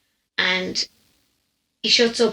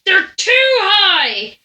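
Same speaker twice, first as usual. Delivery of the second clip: slow, high, loud and drawn out; insistent drunk shout; 9 semitones higher, 4 dB louder, much slower than usual. Retold or imitated. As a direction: bellow it like a mad old man on the bus. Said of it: "he went".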